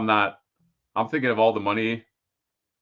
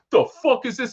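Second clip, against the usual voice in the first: mock soldier; 11 semitones higher than usual. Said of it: "he's like".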